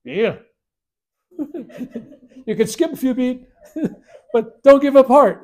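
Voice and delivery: high, loud, bursting with laughter; laughing guru. Retold or imitated. Guru laughing while interrupting the divine voice, loud and high. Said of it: "this guru keeps interrupting her".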